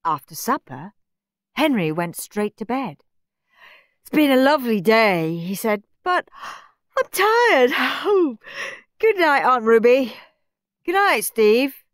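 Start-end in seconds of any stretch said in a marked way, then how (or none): 4.0-5.4 put-on higher, louder boy voice; put-on bedtime boy. A narrator's reading. Henry to his aunt after supper, put-on boy voice. Henry feigns tiredness before bed. 6.0-11.8 high, loud put-on weary boy; hammed-up tiredness. A narrator's reading. Henry says good night to aunt and Steve, feigned tiredness.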